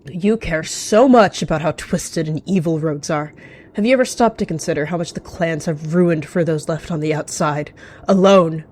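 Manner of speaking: forceful rant building to a loud last word; angry accusation. Acted. Rogue accusing a clan cat mid-fight, angry. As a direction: lay into them, ending on an outburst.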